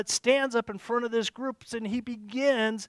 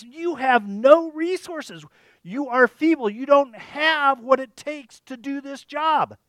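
slow, loud, higher taunt; 5 semitones higher, 7 dB louder, slower than usual. Retold or imitated. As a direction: sneer each word down at them.

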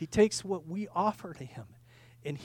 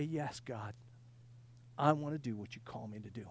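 low, soft yielding; humble prayer; 8 semitones lower, 10 dB quieter than usual. Acted. Preacher models answering God's call, quietly submissive. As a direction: say it as a hushed surrender.

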